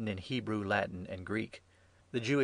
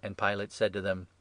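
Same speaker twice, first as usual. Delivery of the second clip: plain reading voice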